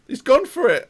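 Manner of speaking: sudden high yell as the shot goes in; real burst of excitement; excited shout. Real, not acted. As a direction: shout it out as the striker shoots. Shot on goal, commentary.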